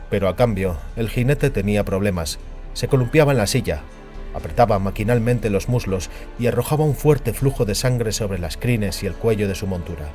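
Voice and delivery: ordinary delivery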